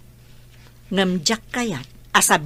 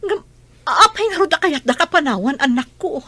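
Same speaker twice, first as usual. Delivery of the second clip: high, louder desperate plea; pleading mother; 6 semitones higher, 5 dB louder than usual. Acted. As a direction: beg them not to go. Mother pleading as children leave. (agpakpakaasi nga ina)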